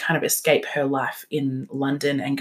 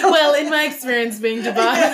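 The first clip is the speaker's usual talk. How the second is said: put-on higher, laughing British accent; mock British accent; 7 semitones higher, 3 dB louder than usual. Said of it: "hard not to talk in that kind".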